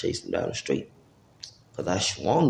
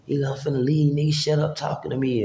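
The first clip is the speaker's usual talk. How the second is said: higher-pitched mock backtalk; mouthy retold comeback, pitched up; smart-mouthed; 7 semitones higher than usual. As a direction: imitate someone getting smart. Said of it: "he getting smart with me".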